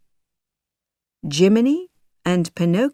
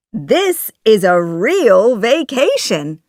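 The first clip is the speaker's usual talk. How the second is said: lively, louder, swooping pitch; a touch of holiday excitement; excited; 4 semitones higher, 6 dB louder than usual. A narrator's reading. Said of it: "He is very excited".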